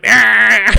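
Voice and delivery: loud imitated groan of protest; mock a friend's loud, disgruntled noise. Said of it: "he always makes those disgruntled voices".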